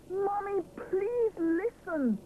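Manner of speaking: high, drawn-out pleading call; desperate shout. A narrator's reading. Rupert begging his mother to hear him, desperate call. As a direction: make her listen at last. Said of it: "shouts Rupert desperately".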